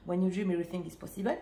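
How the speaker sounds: quick English with a French accent. Real, not acted. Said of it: "j'ai encore mon accent français". (anglais rapide avec accent français)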